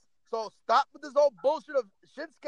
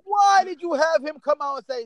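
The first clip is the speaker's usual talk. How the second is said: loud, raised cry of disbelief; exasperated demand; 3 semitones higher, 7 dB louder than usual. Real, not acted. Wrestling fan questions the bookers' storyline, exasperated demand. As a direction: demand an answer, pained and loud.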